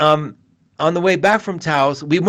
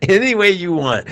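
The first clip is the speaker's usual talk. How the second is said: high laugh spilling into the words; laughing through words; 5 semitones higher than usual. Real, not acted. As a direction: laugh as you say it. Laughing while signing off.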